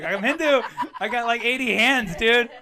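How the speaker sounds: a cocky dude showing off his many hands; mock bro boast. Teasing co-host about temple hands, loud high mock boast.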